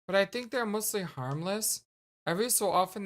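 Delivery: ordinary talk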